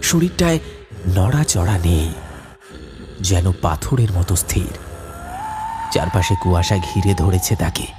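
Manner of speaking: low, hushed eerie narration; story narration. A narrator's reading. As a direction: draw the listener into the creeping dread. Narrator voice describing figure. (নিচু ভৌতিক বর্ণনা)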